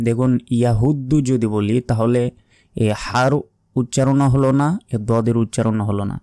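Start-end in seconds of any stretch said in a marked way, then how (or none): none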